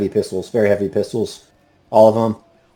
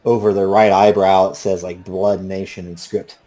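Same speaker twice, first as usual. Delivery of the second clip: ordinary delivery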